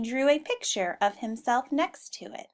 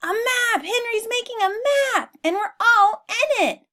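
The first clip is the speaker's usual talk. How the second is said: much higher, louder animal chorus; high squealing animal excitement; excited exclaiming; 13 semitones higher, 7 dB louder than usual. Acted. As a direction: exclaim it in delight. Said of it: "they exclaimed".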